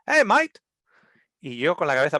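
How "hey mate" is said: high, loud hey mate; loud call. Retold or imitated. Australian customer calling the new barman, loud. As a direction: call out across the bar.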